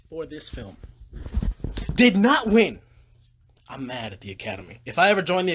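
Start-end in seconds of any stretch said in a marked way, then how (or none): 1.9-2.8 louder, higher, each word hit; indignant. Real, not acted. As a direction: spit it out in outrage. Angry about Oscars.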